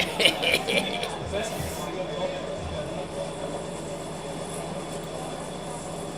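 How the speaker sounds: long airy giggle; breathy self-satisfied laughter; gleeful laugh. Real, not acted. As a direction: laugh at the prank you pulled. Laughs at fooling everyone.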